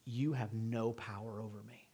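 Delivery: low, quiet, commanding; a hint of Jesus, low and hushed. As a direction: speak with calm divine authority. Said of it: "As if Jesus is saying".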